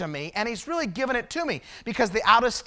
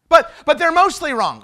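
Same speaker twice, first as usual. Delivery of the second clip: higher, louder stammered objection; mock protest; 5 semitones higher, 8 dB louder than usual. Preacher voices someone excusing themselves, protesting.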